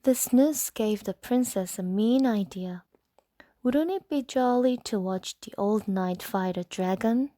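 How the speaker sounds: usual speaking voice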